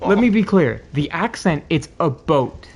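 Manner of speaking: high, loud, deliberate insisting; emphatic insisting. Real, not acted. Correcting friends about the accent, loud emphatic insisting.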